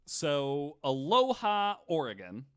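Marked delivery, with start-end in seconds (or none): none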